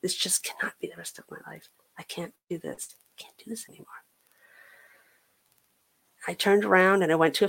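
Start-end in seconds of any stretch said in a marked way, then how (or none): 0.0-3.9 hushed, fading into a whisper; said to herself. Retold or imitated. Woman telling herself her life must change, hushed to a whisper. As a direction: murmur it inwardly, softer until nearly gone. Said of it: "I just said to myself".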